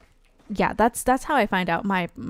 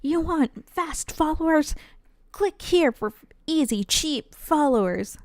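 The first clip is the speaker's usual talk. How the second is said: slow, higher-pitched mock advertising voice; spammer sales pitch; 7 semitones higher, slower than usual. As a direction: hawk it like a scammy bot ad. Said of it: "people try to link their, like".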